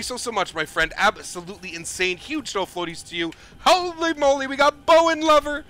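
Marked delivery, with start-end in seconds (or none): 3.6-5.6 higher and louder, thrilled by the donation; excited. Real, not acted. Streamer thanking a donor for a big donation, excited. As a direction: shout out the big donation with real delight.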